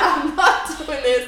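retold refusal, loud, high and drawn-out; fed-up refusal. Her hanging up on partner, fed up.

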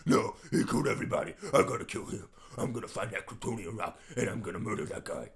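rough, harsh whispery vigilante; Batman. Acted. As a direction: snarl stubbornly like a brooding hero. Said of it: "all of Alfred and Batman's scenes".

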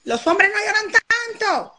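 put-on high stern parent lecturing a boy; scolding parent. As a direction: scold him like a strict old-school father. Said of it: "a los varones".

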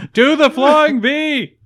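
high, slow, drawn-out fan yell; excited fan yell. Retold or imitated. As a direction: yell it at the TV, cheering the team on. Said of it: "Marco's on his couch".

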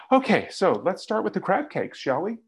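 high, bright change of subject; chirpy pivot. Pretend. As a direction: breeze past it all cheerfully. Pivot to ordering crab cakes.

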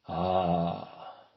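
long low hum, half a sigh. Acted. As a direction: breathe it out low, humming. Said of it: "long and low between a sigh and a hum".

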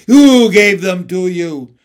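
a queen barking an accusation; evil queen. A narrator's reading. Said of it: "said the witch".

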